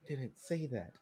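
very soft grumbled aside; imitated under-the-breath reply; muttered objection. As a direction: grumble it to yourself, barely audible. Imitated unspoken grumble at the officer.

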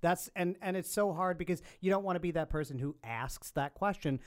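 normal talking voice

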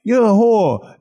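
put-on loud, high Boston-accented yell. Imitating Philadelphia cousins yelling at mom, loud Boston accent. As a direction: bark it in a thick Boston accent. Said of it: "Now I'm doing a Boston accent".

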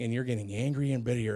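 normal talking voice

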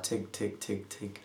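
soft, dropping tick tick like a clock; ticking countdown. Retold or imitated. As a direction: let the ticks fade out quietly. Countdown ticking in his head. (leises Ticken einer Uhr)

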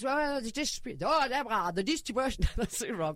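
mock Greek accent on a businessman. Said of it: "Is that your Greek accent?".

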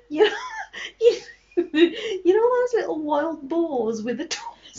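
giggling through the words; laughing while she talks. Real, not acted. Laughing while teasing friend.

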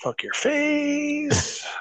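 very high, very slow taunt; squeaky taunt. Pretend. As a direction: stretch it out in a squeaky voice. Taunting teammate, escalating.

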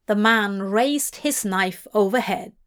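usual speaking voice

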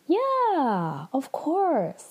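a detached, drawn-out yeah, of course; faraway evasive reply, lightly imitated; very distant. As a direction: answer from far away, noncommittal. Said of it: "like, very distant".

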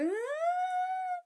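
put-on a long, very high childish wail. Small girl whining to her parents after being scolded, high wail. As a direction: let out a kid's whine of protest.

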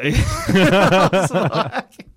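cracking up, high and loud; laughing. Real, not acted. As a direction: laugh helplessly through the words. Cracks up at his own story.

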